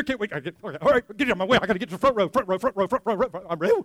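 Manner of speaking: hammed-up, impatient rush of words; frantic eagerness. Churchgoer scrambling for the front row, comically frantic. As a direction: tumble through it like someone pushing past a crowd.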